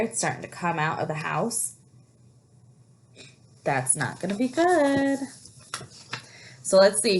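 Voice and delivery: ordinary delivery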